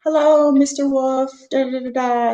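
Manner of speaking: high, slow, overly polite greeting to the dad; imitating a gushing teacher, pitched high; mock teacher greeting. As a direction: sweetly fawn over him in a high voice. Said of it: "they would be like".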